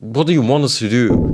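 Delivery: ordinary delivery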